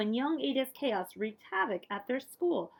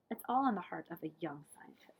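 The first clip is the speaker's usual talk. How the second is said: fast, lower whispered line; 5 semitones lower, 5 dB quieter, faster than usual. A narrator's reading. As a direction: whisper it like a secret. Whispered narration.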